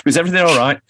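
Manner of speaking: put-on a chirpy northern club-man accent. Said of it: "I can only do it in a northern accent".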